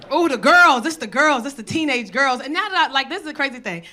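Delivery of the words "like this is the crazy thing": ordinary talk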